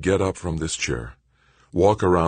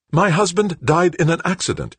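high, loud insistence; insisting; 13 semitones higher, 4 dB louder than usual. A narrator's reading. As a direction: insist on it firmly. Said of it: "Joanna insisted".

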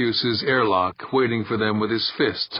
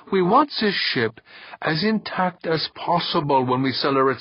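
higher-pitched, exasperated lecture; 7 semitones higher than usual. A narrator's reading. Boss explaining why not to blast the hatch, exasperated. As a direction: spell it out to fools, pitch raised. Boss berates men as fools.

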